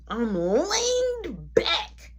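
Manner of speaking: stretched, slow "leaned"; very drawn out. Shown as a demonstration. Man repeating his pose to the listeners, drawn out. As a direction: draw out "leaned" slowly, almost whining. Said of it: "mind you".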